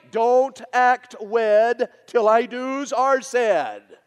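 loud, high-pitched pulpit exhortation; full-force preaching. Real, not acted. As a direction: thunder out the point to the crowd. Preaches his sermon at full force.

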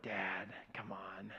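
whispered, slow embarrassed plea; embarrassed daughters. Retold or imitated. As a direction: hush him like an embarrassed teen. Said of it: "They're like".

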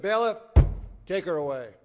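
lightly voiced stern judge, loud command. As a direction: order it firmly and loudly, a touch stern. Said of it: "looked at her sternly and said".

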